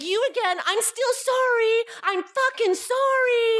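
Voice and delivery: high, drawn-out mock-apology yell. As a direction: hurl a stretched-out sarcastic sorry at him. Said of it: "I kept yelling at him".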